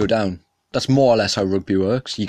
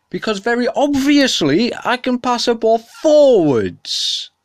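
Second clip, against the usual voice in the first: higher, louder and slow, hammering each word; defensive rant; 11 semitones higher, 5 dB louder, slower than usual. Real, not acted. Ranting at viewers about rugby, defensive shouting. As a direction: shout it like you're sick of explaining. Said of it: "very defensive about the subject".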